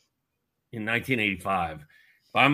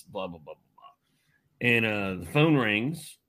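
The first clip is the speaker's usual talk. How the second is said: ordinary talk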